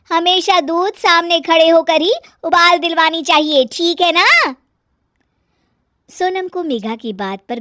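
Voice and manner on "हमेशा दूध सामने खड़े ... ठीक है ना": high, loud bossy lecture; bossy raised-voice correction; nagging daughter-in-law, lecturing. Acted. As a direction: lay down the rule, loud and high. Fault-finding sister-in-law scolds in story.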